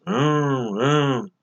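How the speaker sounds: drawn-out humming engine revs; imitated engine noise. Shown as a demonstration. Teacher imitating a car engine accelerating, humming revs. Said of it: "El motor hace un sonido que es".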